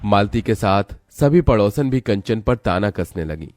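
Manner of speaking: usual speaking voice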